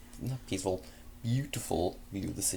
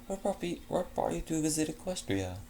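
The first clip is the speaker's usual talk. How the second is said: Fleur voice; 8 semitones higher than usual. Acted.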